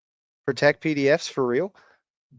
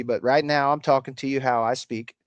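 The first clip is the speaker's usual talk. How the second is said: ordinary delivery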